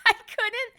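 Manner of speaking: bursting into a high laugh mid-word; giggly nervous burst. Real, not acted. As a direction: laugh through the words, way up high and loud. Nervous meeting retold with laughter.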